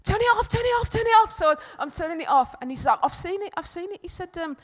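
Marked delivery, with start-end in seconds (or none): 0.0-1.3 high, loud repeated shout; urgent shouting. Retold or imitated. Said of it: "I could hear him shouting". 3.0-4.0 high-pitched excited report; excited calling out. Retold or imitated. Husband calling out his discovery.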